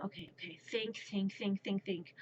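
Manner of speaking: quiet, repetitive thinking aloud; anxious self-talk. Retold or imitated. Babysitter working out why baby cries, anxious quiet self-talk. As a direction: talk yourself through it under your breath.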